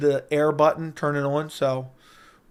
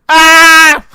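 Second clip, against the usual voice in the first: scream; 12 semitones higher, 15 dB louder than usual. Acted. Sudden scream into mic.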